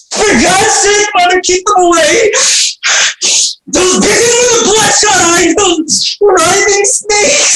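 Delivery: high desperate screaming in terror; terrified screaming. Acted. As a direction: shriek at the monsters in terror. Orestes hallucinates the Furies.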